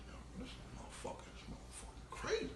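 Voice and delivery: hushed, breathless amazement; awed whisper. Retold or imitated. Aside to himself about the kid's rap, awed whisper. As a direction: whisper it like you can't believe it.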